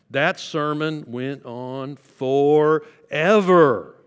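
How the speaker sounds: drawn out very slowly, loud and groaning; hammed-up weary exaggeration; exaggerated complaint. Shown as a demonstration. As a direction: stretch it out to sound endless. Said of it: "hyperbole would be something like this".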